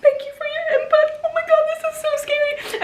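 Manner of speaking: very high, tiny frightened voice; overwhelmed and scared, in a squeaky voice; mock scared. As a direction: squeak it out like you're terrified. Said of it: "I'm getting yelled at by these people".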